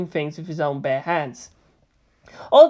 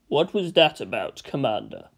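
Space Marine voice; 7 semitones lower, 4 dB quieter than usual. A narrator's reading.

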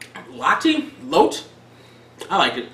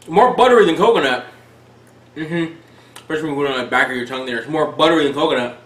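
talking between bites; chewing while talking; 5 semitones lower, faster than usual. Real, not acted. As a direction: mouth partly full. Seen 1: Holds cracker bits throughout, brings piece to mouth at 8.5s.

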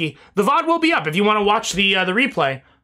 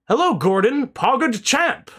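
a hint of the scientist's greeting, a bit higher; attempted scientist voice; 6 semitones higher, much slower than usual. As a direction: lightly mimic the scientist saying hello. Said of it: "Can't do the scientist voice".

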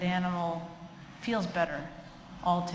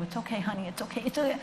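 fussy comforter; 3 semitones higher, much faster than usual. Retold or imitated. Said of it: "not desperately patting you".

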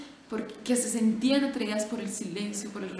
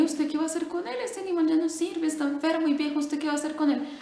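higher, bemused questioning; a hint of a baffled bird seller; puzzled seller; 7 semitones higher, 3 dB louder, faster than usual. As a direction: ask why he'd want it, pitched up.

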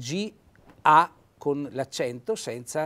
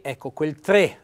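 usual speaking voice

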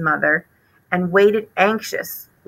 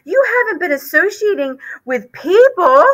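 higher, anxious mother's voice; worried mother mouse; 11 semitones higher than usual. Acted. Mother mouse fearing for her son, higher and anxious.

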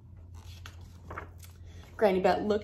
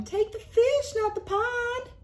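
higher calling out; high urgent call. Acted. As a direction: call after him. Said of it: "yelled Reel-a-Min Sam".

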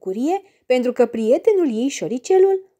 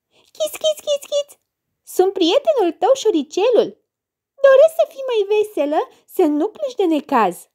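very high, squeaky little mouse voice; high mouse chirping to the girl; 10 semitones higher, 3 dB louder than usual. Acted. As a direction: squeak it like a tiny friendly mouse.

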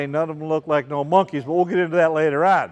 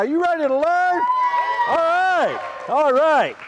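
much higher, pumped-up call to the crowd; excited hype; 10 semitones higher than usual. Shown as a demonstration. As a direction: fire the kids up with "learn" rising. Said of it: "Come on, we gotta get excited now".

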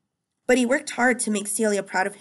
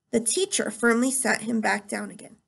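ordinary delivery

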